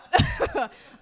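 loud high laugh; laughing at the awkward confession; high burst of laughter. Real, not acted. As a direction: let a big laugh break out. Laugh after confession story.

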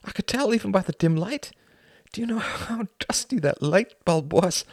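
high-pitched, agitated storytelling; distraught confession. Acted. Upset man recounting his night to a friend, distraught.

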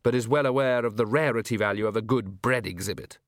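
ordinary delivery

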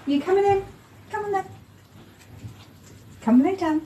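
high sing-song coaxing call to a dog. Real, not acted. Host calls her dog Lou in from outside, high coaxing pet voice. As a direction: coax her in with a lilting pet voice.

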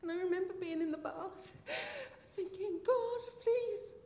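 real tears rising in a church testimony; tearful. Real, not acted. Retelling despair, holding back tears.

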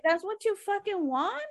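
high, drawn-out indignant question; retold exasperated challenge. Fed-up customer to the clerk who ignored them, retold exasperated challenge. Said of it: "cuando le dije así".

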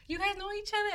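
high, wide-eyed imitation; imitating the lady realizing they are cousins; mock surprise. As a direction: squeak the question up high.

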